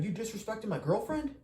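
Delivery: high, loud, mocking tough-guy challenge; hammed-up high-pitched imitation of an angry stranger; aggressive challenge. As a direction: squeal it out like an offended drunk picking a fight. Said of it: "He's like".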